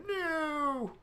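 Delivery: high, drawn-out cry of "No!"; office workers' cry. Retold or imitated. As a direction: cry out like a panicked clerk. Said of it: "men in the little offices going".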